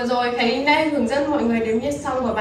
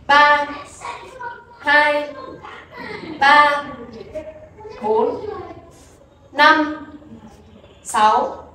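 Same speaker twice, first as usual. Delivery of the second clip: counting dance beats; 3 semitones higher, much slower than usual. Shown as a demonstration. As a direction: call out each beat for the dancers. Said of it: "Tổ hợp thứ hai". (đếm nhịp nhảy to, dứt khoát)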